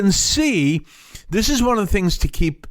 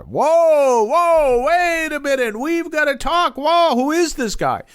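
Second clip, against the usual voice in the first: astonished reaction; 13 semitones higher than usual. Acted. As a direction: stop everything in amazed disbelief. Acting crowd's amazed reaction to new leader.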